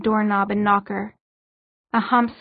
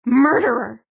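terrified accusation; 5 dB louder than usual. A narrator's reading. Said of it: "unrecognizable with terror".

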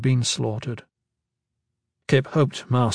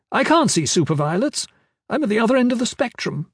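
put-on old woman; 10 semitones higher than usual. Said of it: "the old woman asked".

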